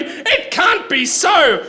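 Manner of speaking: high, slow mock scream with a laugh; screamed denial. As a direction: scream it in disbelief, half laughing. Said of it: "he screamed".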